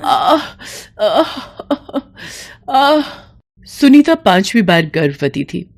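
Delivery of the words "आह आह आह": high cries of pain; high pained aah; pained moans. Acted. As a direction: moan as if kicked. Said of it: "दर्द से करा उठी".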